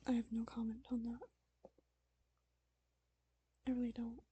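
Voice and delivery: soft, hushed betrayed lover; heartbroken whisper. Pretend. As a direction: whisper each reproach softly, wounded. Player voices betrayed character in whisper.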